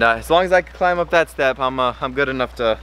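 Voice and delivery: ordinary talk, nothing marked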